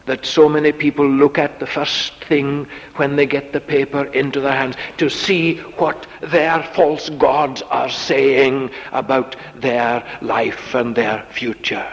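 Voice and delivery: usual speaking voice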